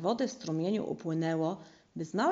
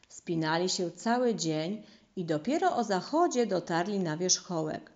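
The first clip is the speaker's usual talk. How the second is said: ordinary delivery, nothing marked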